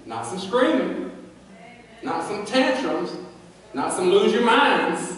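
slow rising preacher emphasis; emphatic list. Real, not acted. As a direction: punch screaming, tantrums, minds one by one.

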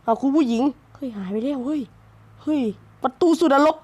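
normal talking voice

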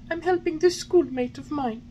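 put-on high wooden-boy voice, anxious; high puppet boy. A narrator's reading. Pinocchio answering the carabineers, anxious and high.

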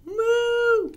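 little cow's loud, very high moo. Acted. Reader voices a little cow, high moo.

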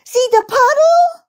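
put-on loud, very high child's cry; shouted warning. A narrator's reading. Rabbit girl warning of puddle, high loud shout. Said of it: "shouted Baby Bunty".

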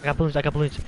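ordinary delivery

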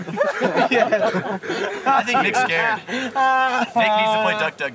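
laughing mock spooky. Mocking scary storyteller voice.

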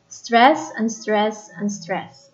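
strong "Stress", then two fading "unstressed"; dactyl stress pattern. Shown as a demonstration. Teacher showing class a dactyl, strong "Stress", weak "unstressed". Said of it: "The fourth one is dactyl".

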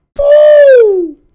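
long high loud raspberry hoot; mocking hoot at the foe. Real, not acted. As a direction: blow a high squealing boo. Mocking an enemy Pokémon.

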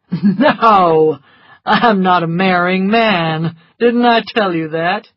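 laughing off the very idea; loud, scoffing amusement; amused dismissal. A narrator's reading. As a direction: brush it off with a laugh. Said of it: "laughed loudly".

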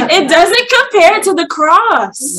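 high, loud, drawn-out cry through a laugh; emphatic exclamation. Real, not acted. Preacher telling sisters nothing compares, high loud exclamation. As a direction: proclaim it loudly, stretching the words. Exclaims after listing small sacrifices.

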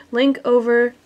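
normal talking voice

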